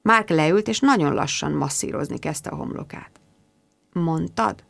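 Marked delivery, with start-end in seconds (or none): 3.9-4.6 hushed murmur. Acted.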